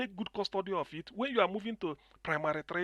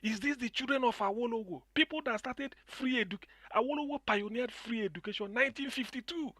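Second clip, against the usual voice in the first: rising into a passionate higher-pitched rant; excited; 8 semitones higher, 5 dB louder than usual. Real, not acted. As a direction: get louder and higher as the outrage builds. Caller grows heated about Awolowo.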